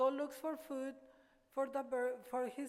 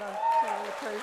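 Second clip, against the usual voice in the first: comic punchline; 11 dB louder, much faster than usual. Real, not acted. Punchline to laughing audience.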